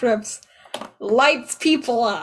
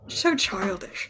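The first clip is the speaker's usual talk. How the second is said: disdainful; 4 semitones lower, 7 dB quieter, slower than usual. Acted.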